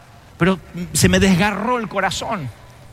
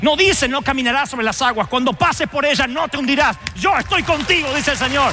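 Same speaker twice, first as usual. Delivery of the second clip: preaching at full force; 7 semitones higher than usual. Real, not acted. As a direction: preach it out with fervor. Clímax del sermón. (predicación a plena voz)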